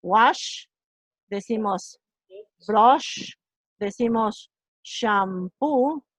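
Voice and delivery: wash brush shampoo with a long sh; English sh words. Shown as a demonstration. Teacher models sh words for the class. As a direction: drag out the sh in shampoo. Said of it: "En inglés decimos".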